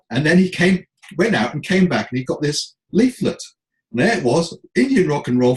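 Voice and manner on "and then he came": ordinary talk, nothing marked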